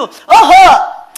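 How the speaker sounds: a long, loud drawn-out 어허 pitched high; stern scolding. Retold or imitated. Mother stopping her son's pleading, drawn-out loud rebuke. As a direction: cut the boy off with a loud 어허!.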